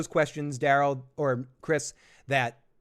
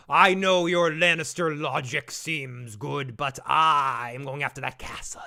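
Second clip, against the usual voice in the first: drawn-out, hammed-up villain delivery; scenery-chewing villain. Villain answers the Lannister, hammy and drawn-out. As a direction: stretch the words out, chewing the scenery. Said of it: "eating scenery".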